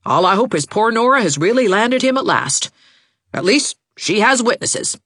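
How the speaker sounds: high, emphatic aunt's verdict; pointed remark. A narrator's reading. Aunt's tart closing comment.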